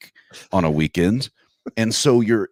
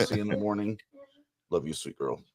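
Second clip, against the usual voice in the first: tender goodnight; 13 dB quieter, faster than usual. Real, not acted. Father saying goodnight to his little girl, soft and tender. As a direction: hush it down like tucking in a daughter. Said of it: "saying good night to his children".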